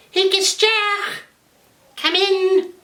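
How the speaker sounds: very high, slow falsetto granny voice; disguised wolf calling to the door; wolf faking Granny. Acted. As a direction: squeak it out like a wolf pretending to be an old lady.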